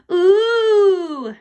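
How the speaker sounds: loud, high drawn-out groan; long, loud "ooh" of dismay; audience groan. A narrator's reading. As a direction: groan like a let-down crowd. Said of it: "the audience groaned".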